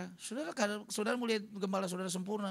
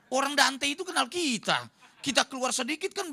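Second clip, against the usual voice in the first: ordinary delivery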